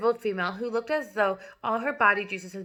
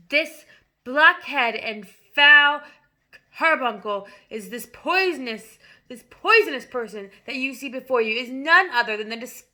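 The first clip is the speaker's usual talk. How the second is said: ranting tyrant headmistress; 3 semitones higher, 8 dB louder than usual. A narrator's reading. Headmistress denouncing the boy to the whole school. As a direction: spit every insult at him. Trunchbull tirade.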